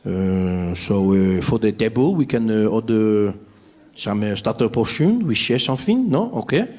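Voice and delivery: put-on low, halting French tourist accent; hesitant French diner ordering slowly. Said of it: "French people sitting ... looking at the menu like".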